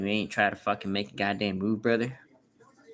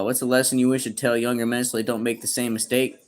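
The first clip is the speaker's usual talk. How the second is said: normal talking voice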